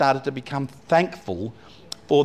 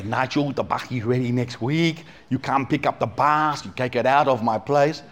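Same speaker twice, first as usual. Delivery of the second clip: put-on Vietnamese accent. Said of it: "my Vietnamese one".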